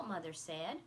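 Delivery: ordinary delivery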